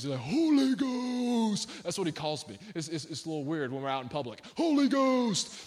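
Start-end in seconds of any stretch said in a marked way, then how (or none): 0.2-1.8 long drawn-out high holler; Rusty Domain. Retold or imitated. Preacher imitating a crazy church friend's greeting, drawn-out holler. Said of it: "he talks like this". 4.6-5.6 high, slow mock holler; Rusty Domain. Preacher mimicking a friend hailing him in public, high holler. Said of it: "he talks like this".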